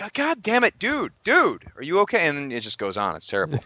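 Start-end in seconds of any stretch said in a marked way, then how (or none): 0.0-2.3 high-pitched, dismayed reaction; mock exasperated concern. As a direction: plead with a friend in high exasperation. Retold alarmed reply to friend.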